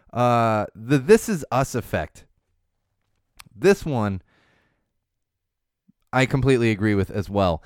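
ordinary talk, nothing marked

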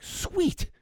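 high, whispered sweet; a breathless, gleeful little aside; mock delight. Imagined reply to boycotters.

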